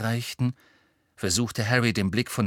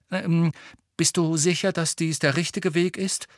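higher-pitched, pointed question; 6 semitones higher than usual. A narrator's reading. Harry doubting Hermine about the path, pointedly. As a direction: ask it with a needling edge. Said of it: "fragte er sie spitz".

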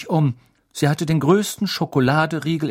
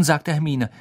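usual speaking voice

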